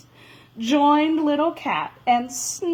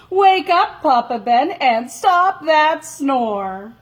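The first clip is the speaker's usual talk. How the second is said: loud call; 4 semitones higher, 6 dB louder than usual. Acted. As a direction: call out loud to wake her. Calling to Papa Ben.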